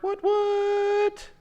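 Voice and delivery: high, loud cartoon squeak on what what; loud squeaky double what. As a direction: shriek it like a cartoon character. Hyper art reveal again.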